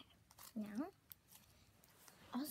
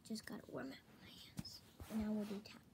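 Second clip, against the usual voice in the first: whispering; 4 dB quieter than usual. Real, not acted. ASMR whisper.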